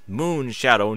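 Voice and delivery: ordinary delivery, nothing marked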